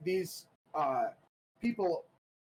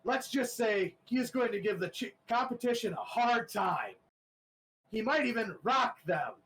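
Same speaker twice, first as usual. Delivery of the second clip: a lightly voiced, slightly higher contender; smug little joke; 6 semitones higher than usual. Contender making a pun to the crowd, lightly voiced. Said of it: "you hear the contender say".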